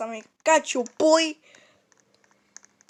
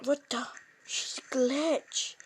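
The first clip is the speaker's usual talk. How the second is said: whisper; 9 dB quieter, slower than usual. Real, not acted. Unvoiced whisper.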